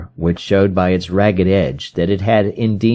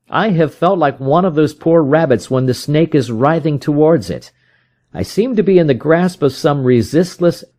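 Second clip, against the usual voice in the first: put-on a lightly raised, troubled young man's voice; anxious young man; 7 semitones higher than usual. A narrator's reading. Young man tells Holmes of his helplessness, lightly anxious. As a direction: voice a frightened client pouring out his fear. Openshaw confides his fear.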